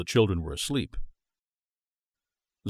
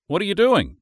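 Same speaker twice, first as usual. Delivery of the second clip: lightly voiced brisk wife; a hint of a busy wife; Julia's voice; 11 semitones higher, 7 dB louder than usual. A narrator's reading.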